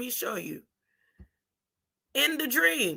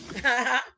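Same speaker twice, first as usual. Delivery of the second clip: brief two-beat chuckle; short laugh. Real, not acted. Daughter amused by mother's answer, short laugh.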